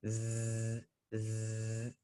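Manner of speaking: very soft, drawn-out buzzing Z. Shown as a demonstration. Teacher demonstrating a very clear buzzing Z. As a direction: let the Z buzz like a bee. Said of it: "Just like a buzz when it's buzzing".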